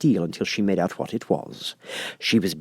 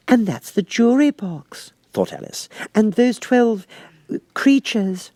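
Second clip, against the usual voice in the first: put-on high, light girlish voice; lightly high Alice wishing; 12 semitones higher, 3 dB louder, slower than usual. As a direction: voice young Alice, a little impatient. Alice's voice in read story.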